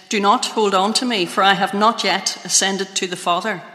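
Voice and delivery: usual speaking voice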